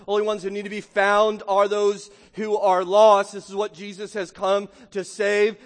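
forceful, driving sermon delivery; impassioned preaching. Real, not acted. Preacher promises sinners that anyone can be saved, impassioned preaching. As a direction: press each key word home.

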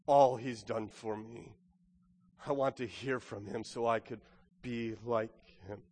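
hushed and sincere, inviting reflection; gentle, searching appeal. Real, not acted. Preacher turns gently to the congregation.

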